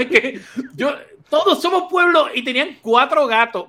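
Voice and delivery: laughing through the words, pitched high; real amusement. Real, not acted. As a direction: crack up while scoffing at the turnout. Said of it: "A mí me da gracia".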